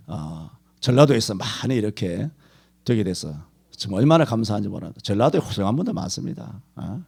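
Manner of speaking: usual speaking voice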